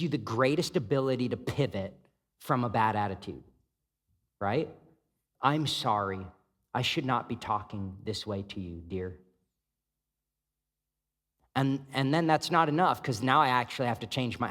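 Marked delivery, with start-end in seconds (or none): 5.4-9.2 soft apology. Retold or imitated. Apologizing to spouse, inner voice.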